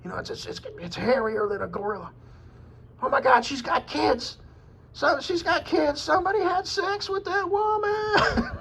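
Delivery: crank it way up high like a stunned yokel yelling to Larry; mimicking a comedian's shocked onlooker bit; excited comic impression. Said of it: "he was talking like".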